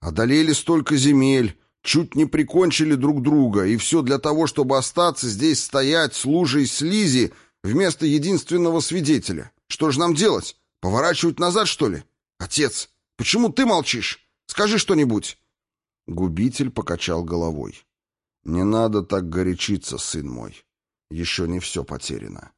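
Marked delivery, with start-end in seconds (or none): 0.0-15.4 high-pitched, agitated tirade; heated outburst. A narrator's reading. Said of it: "глаза его метали пламя". 18.4-22.5 calm reassurance. Acted. Отец успокаивает горячащегося сына.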